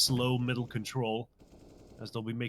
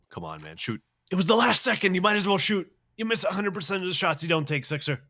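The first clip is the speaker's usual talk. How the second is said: frustrated shout; 6 semitones higher, 4 dB louder than usual. Real, not acted. Caster frustrated at a held shot.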